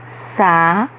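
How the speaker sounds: "Sa-bi-a" slowly split into three, last syllable rising. Shown as a demonstration. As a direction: separate each syllable of "Sa-bi-a", lifting the final a. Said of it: "pronunciando cada uma de suas sílabas". ("Sa-bi-a" sílaba por sílaba, subindo no último a)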